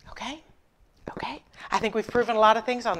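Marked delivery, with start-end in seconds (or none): 0.1-1.4 breathy, whispered question. Real, not acted. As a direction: whisper it teasingly. Whispered aside.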